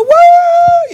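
imitated high, loud "woo" of a game show winner; long high mock cheer; excited winner's whoop. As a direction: whoop like you just won money. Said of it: "Think about how excited people get on these game shows".

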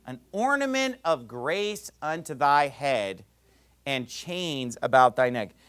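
slow repeat of the verse; slowed, thoughtful restatement; slow emphasis. Shown as a demonstration. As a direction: linger on each word.

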